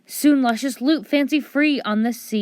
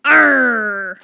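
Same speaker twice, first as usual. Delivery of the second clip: pirate growl; 2 semitones higher, 8 dB louder than usual. Acted.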